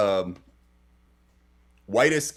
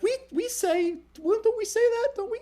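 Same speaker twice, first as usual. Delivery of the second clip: very high mock-mom voice; flustered mother. Mother dodging the I love you question, flustered. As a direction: pitch it way up, stammering and dodging.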